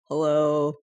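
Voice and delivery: imitating a solemn, low hello; mock serious. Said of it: "You sounded so serious. You're like".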